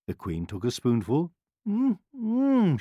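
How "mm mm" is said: high, sweet hum of tasting; sweetly. A narrator's reading. Queen tasting the medicine for her son, sweetly. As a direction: hum it sweetly, pitched well up. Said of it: "she said sweetly".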